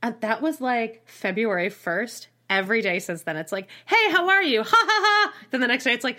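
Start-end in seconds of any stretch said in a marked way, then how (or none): 3.9-5.3 much higher, forced fake ha ha; hammed-up chirpy laugh; mock cheery texter. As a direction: parody a clueless guy's chirpy text. Mocking his fake-cheery texts.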